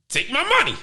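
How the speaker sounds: ordinary talk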